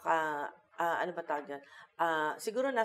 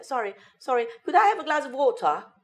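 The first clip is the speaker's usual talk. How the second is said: high, polite British request with dropped R; British "water" said as "wo-tah"; British accent; 8 semitones higher, 6 dB louder than usual. Shown as a demonstration. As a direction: order politely in hammed-up British English. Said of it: "magsasample ako ng British accent".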